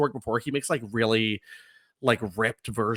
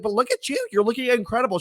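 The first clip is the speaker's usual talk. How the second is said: high-pitched admiring gush; retold gushing compliment; 11 semitones higher, faster than usual. Fan complimenting Nydia's looks, re-enacted high gushing. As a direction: hype someone up, pitched way up. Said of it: "I was like, I was like".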